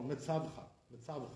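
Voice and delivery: Metzavcha as me-TSAV-cha, tailing off; wrong early stress. Shown as a demonstration. Teacher showing another wrong way, Metzavcha stressed early. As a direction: stress "tzav" and swallow the rest of Metzavcha. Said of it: "Wrong way".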